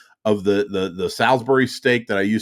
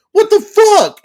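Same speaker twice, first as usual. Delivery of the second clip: higher, louder shocked cry; shocked outburst; 9 dB louder, slower than usual. Retold or imitated. Telling the podcast cohost about the raccoon attack, a loud high shocked cry. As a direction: blurt it out in disbelief. Retelling the raccoon standing its ground.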